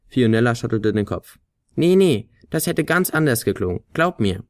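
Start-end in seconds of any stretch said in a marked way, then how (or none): 1.7-4.4 high insistent flute voice; Flöte. A narrator's reading. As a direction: brush the idea off. Flöte widerspricht. (hohe Flöte, bestimmt abwinkend)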